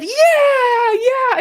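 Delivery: high, loud cheering yes; cheering shout. Retold or imitated. Group cheering a member's confessed failure, loud whooping shout.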